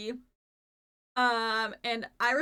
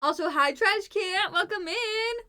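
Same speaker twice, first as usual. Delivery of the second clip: much higher, cutesy welcome; squeaky high greeting; 8 semitones higher, 5 dB louder, slower than usual. Real, not acted. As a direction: greet them in a squeaky cute voice.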